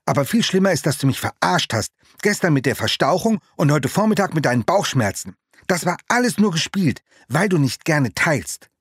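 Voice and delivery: angry accusation. Acted. Rico accuses his friend angrily.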